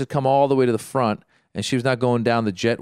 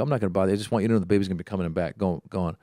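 low, fast, dismissive retort; retold passive-aggressive brush-off, tailing off quieter; 5 semitones lower, 5 dB quieter, much faster than usual. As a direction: rattle it off low with mock politeness. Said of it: "doing a very passive aggressive thing".

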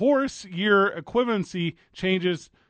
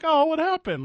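high surprised gasp of a question; sudden high-pitched worry; mock alarmed; 11 semitones higher, 3 dB louder than usual. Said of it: "I was like".

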